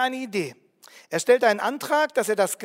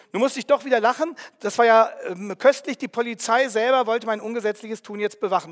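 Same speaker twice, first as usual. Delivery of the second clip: ordinary delivery, nothing marked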